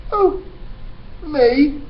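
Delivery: high innocent surprise; puzzled. Acted. Boy puzzled at being called a criminal. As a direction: ask it in honest confusion. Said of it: "looking genuinely puzzled".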